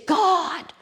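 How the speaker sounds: high-pitched, anguished demand, drawn out; angry cry to God. Retold or imitated. Storyteller voices angry boy questioning God after the flood, angry cry. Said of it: "He was angry".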